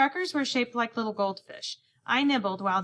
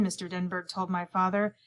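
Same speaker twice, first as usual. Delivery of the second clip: plain reading voice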